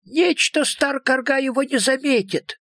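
high-pitched dialect-speaking little creature; second creature voice. A narrator's reading. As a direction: answer in a high, folksy character voice. Second unseen voice answers in dialect. (высокий простонародный голосок существа)

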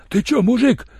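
high, frightened wail; wailing plea. A narrator's reading. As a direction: whine it out in panic, pleading. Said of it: "запричитал седой негр". (испуганно, высоким причитающим голосом)

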